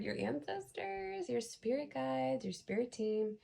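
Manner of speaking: high, soft, drawn-out list; slow sing-song, stepping down in pitch; playful lilt. Pretend. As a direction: lilt each item, starting very high. Listing spirit helpers playfully.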